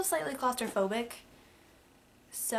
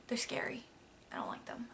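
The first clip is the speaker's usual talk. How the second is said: scared whisper; 9 dB quieter than usual. Real, not acted. Confessing fear of elevators.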